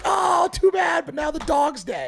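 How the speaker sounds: high, slow exaggerated shout; mock wise voice. Mocking someone's sad trophy speech, high slow shout. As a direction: stretch it out high and loud. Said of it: "You're like".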